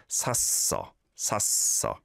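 샀어 in a whisper, tense ㅆ stressed. Shown as a demonstration. Teacher whispers 샀어 to show the tense 시옷. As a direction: breathe out 샀어 with a hard doubled ㅆ. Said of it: "it has to be on that as well as the blend".

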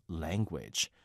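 normal talking voice

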